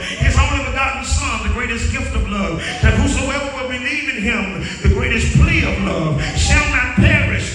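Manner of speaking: loud, rising sermon cadence; preaching. Acted. Preacher to congregation, full-force preaching.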